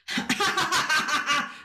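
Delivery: high puppet giggle; rapid, high-pitched little boy's laugh; Marvin. Acted. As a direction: giggle in character as a delighted puppet. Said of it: "And he laughs like that".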